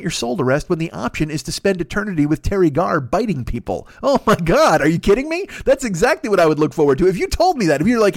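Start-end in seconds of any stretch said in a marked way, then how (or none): 4.0-8.1 excited. Real, not acted. Excited rant about zombie afterlife.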